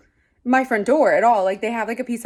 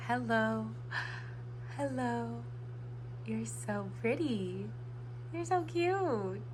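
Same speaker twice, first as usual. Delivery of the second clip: soft cooing; 3 semitones higher, 13 dB quieter, slower than usual. Real, not acted. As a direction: coo softly like to a pet. Cooing at something cute on camera.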